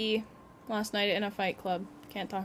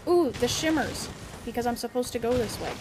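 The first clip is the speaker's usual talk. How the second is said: normal talking voice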